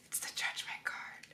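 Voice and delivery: awed hushed whisper. Real, not acted. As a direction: breathe it out in disbelief. Awed card reveal.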